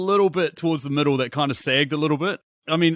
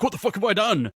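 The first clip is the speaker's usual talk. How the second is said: higher-pitched, acted-out dismay; a touch of horrified realization; mock panic; 7 semitones higher than usual. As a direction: imitate a man coming undone. This mess at the end.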